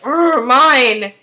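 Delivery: imitated growl, then a loud possessive word; mock animal snarl, fiercely possessive; territorial lion. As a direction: growl like a lioness guarding her cub. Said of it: "really, like, territorial".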